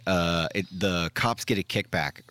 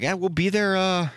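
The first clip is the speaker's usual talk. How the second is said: high, louder mock dispatcher voice; noncommittal dispatcher; 13 semitones higher, 4 dB louder, slower than usual. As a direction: stall vaguely on a high, drawn-out "uh". Mocking vague roadside service answer.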